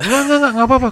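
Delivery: high, quick insistent no-no-no; eager reassurance. Retold or imitated. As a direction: brush it off eagerly, pitch up. Lecturer eagerly reassures student. (dosen menenangkan mahasiswa, nada tinggi bersemangat)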